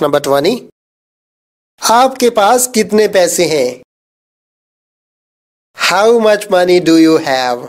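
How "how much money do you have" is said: slow, careful English sentence; slow model reading. Shown as a demonstration. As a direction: say each word slowly and clearly. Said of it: "teacher says sentence slowly".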